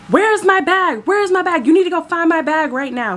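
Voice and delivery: high, frantic mock yell; high-pitched retold screech without full volume; imitated screaming. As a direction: mimic an irate passenger demanding action. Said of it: "she starts screaming again".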